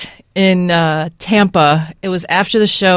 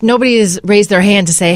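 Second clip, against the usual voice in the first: ordinary delivery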